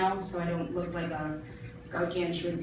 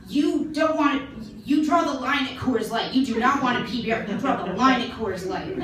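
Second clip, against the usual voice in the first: sarcastic mock disbelief; 5 semitones higher, 5 dB louder than usual. Mocking customer at bar.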